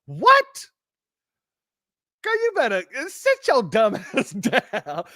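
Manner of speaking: high, incredulous squawk dropping into laughing dismissal; hammed-up scolding; mock outrage. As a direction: go off on her in disbelief. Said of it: "everybody went off on her like".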